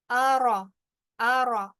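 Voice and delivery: ordinary talk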